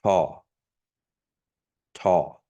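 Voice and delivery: Taw with a breathy t; regular T. Shown as a demonstration. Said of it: "the regular T sound for taw".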